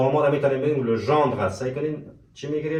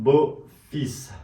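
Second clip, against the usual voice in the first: slow demo; much slower than usual. Shown as a demonstration. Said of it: "چی داریم؟".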